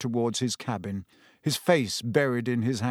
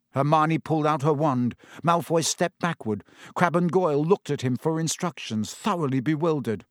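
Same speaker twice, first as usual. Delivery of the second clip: plain reading voice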